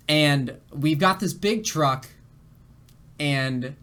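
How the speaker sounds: ordinary talk, nothing marked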